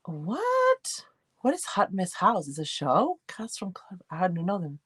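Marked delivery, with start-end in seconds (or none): 0.0-1.0 sudden high squeak of surprise; surprised what. Real, not acted. Streamer reading a baffling comment, jumping high in surprise. 3.3-4.8 breathy whisper, dropped to nothing; whispered aside. Real, not acted. Streamer admitting to chat she doesn't know them, whispering. Aside about unknown show.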